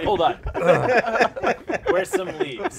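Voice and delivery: high, loud laughing panic. Retold or imitated. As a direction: panic while cracking up. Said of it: "It's like".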